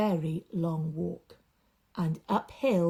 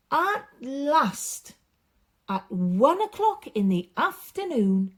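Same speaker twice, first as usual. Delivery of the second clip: loud, higher triumphant arrival; excited relief; 6 semitones higher, 5 dB louder than usual. A narrator's reading.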